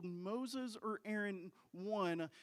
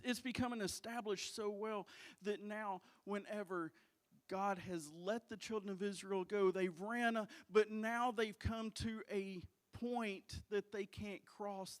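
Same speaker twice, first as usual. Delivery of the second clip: normal talking voice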